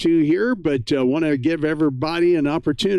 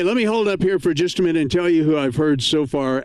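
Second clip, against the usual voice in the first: ordinary talk, nothing marked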